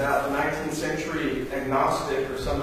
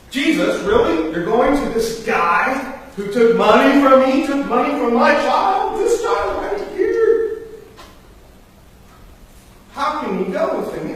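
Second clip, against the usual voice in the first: high, loud outraged complaint; indignant protest; 9 semitones higher, 8 dB louder than usual. Acted. An upset townsman protesting to Jesus, loud and high. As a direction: shout your grievance at Jesus.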